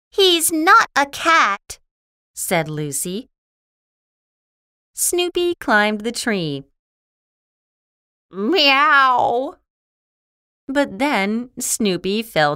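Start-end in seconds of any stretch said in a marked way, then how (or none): 0.1-1.8 high, loud retort; scornful Lucy. Acted. 8.3-9.6 high, loud drawn-out meow; cat meow. Acted. Snoopy imitates a cat.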